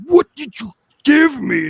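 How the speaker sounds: high loud slow shout; shouted accusation. Retold or imitated. Kid running out to stepbrother, loud high accusing shout. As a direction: yell at him for tricking you. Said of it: "running out and being like".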